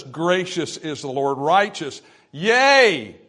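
slow, building to a high, loud burst on Yea; outburst of praise. Retold or imitated. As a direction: let the praise break out, climbing to Yea. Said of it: "an outburst of praise".

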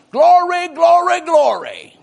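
high, loud, drawn-out shout of glory; exultant shout. Acted. Preacher reading a new convert's salvation testimony, exultant shouting. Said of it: "he arose shouting".

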